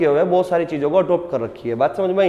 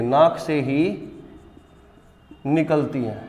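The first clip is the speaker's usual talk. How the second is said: words spaced out with long pauses for writing. Acted. Teacher dictates notes to students.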